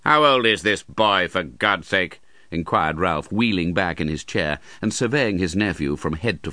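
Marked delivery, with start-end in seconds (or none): 0.0-2.2 scornful. Acted. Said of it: "with intense scorn".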